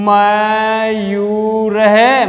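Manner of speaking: छात्र है drawn out, visarga breathed as है; slow visarga reading. Shown as a demonstration. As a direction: model it slowly. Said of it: "नीचे वाली में आ जाओ".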